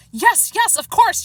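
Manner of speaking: high, loud impatient snap; snapping. A narrator's reading. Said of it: "Chester snapped".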